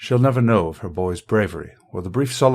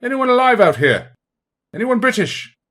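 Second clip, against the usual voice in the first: loud, high-pitched shouted call; gruff British bellow; 7 dB louder than usual. Acted. British soldier calls for survivors from nearby, gruff bellow. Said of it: "a gruff British voice bellows from a short distance away".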